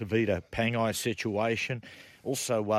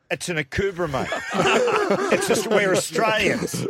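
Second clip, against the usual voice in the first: laughing Eddie impression; 10 semitones higher, 8 dB louder than usual. Mimicking Eddie answering hat question.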